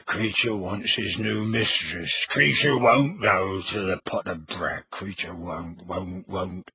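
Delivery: low, slow muttering elf, fading away; croaking house elf. A narrator's reading. House elf muttering about his rightful mistress. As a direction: grumble like a sullen elf.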